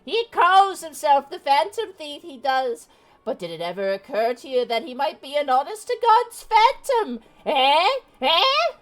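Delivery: high, wailing doom-crying granny; old lady voice. Acted. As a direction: wail of doom like an old crone. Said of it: "my old lady voice".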